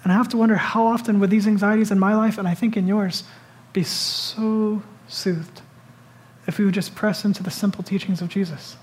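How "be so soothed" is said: very slow and soft; soft, drawn-out comfort; soothed. Real, not acted. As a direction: let the words melt out slowly.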